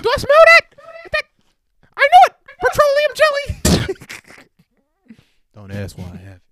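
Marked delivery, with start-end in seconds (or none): 0.0-4.4 high loud mock voice. Acting out AirPod bit.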